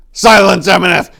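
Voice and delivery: loud, high sharp command. Acted. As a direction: order him to be silent. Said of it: "Tor commanded".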